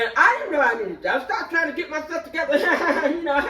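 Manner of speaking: giggling as she tries to get it together; chuckling while retelling the moment; laughing. Real, not acted. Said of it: "laughing while telling".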